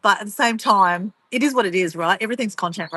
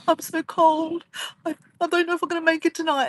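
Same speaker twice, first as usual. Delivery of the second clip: mock freezing; 8 semitones higher than usual. Pretend freezing video voice.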